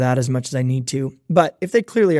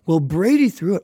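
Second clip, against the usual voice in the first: higher-pitched mock kid blaming someone else; child making excuses; 4 semitones higher than usual. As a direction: imitate a kid whining an excuse. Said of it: "If the first words out of your mouth are".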